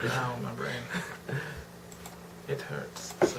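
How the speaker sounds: soft mock pained groan; fading pained murmur. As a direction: let the pain fade to a murmur.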